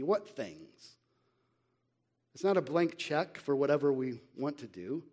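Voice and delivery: ordinary talk, nothing marked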